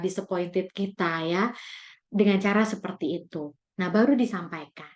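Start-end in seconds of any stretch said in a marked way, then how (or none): none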